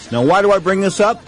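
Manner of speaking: usual speaking voice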